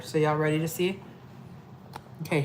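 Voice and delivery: normal talking voice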